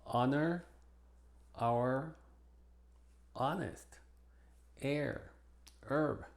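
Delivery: "Honor, our, honest, air, herb" starting on a vowel; "Honor, honest, herb" as onor, onest, erb; silent initial H. Shown as a demonstration. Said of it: "es muda al principio".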